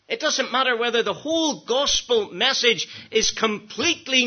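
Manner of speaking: high, ringing pulpit delivery; impassioned preaching. Real, not acted. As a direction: proclaim it to the whole congregation. Preaching at full force.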